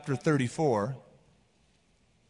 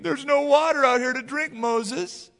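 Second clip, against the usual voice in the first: high mock whine; imitated grumbler, pitched way up; complaining; 9 semitones higher than usual. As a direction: whine it accusingly at the leader. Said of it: "they started complaining again".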